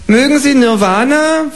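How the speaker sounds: high, chirpy kangaroo asking for eggs. Acted. Kangaroo asking the neighbour for eggs, high and chirpy. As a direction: pipe up like a polite cheeky kangaroo. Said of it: "fragt es".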